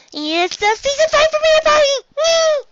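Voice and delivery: very high, loud squeal of joy; squeaky party-pony cheer. Acted. Pinkie Pie plush cheering the premiere party, ecstatic squeal.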